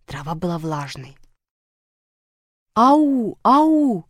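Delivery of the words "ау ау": high, loud halloo; long, raised searching calls; calling out. A narrator's reading. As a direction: call into the woods for someone lost. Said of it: "крикнули буквы".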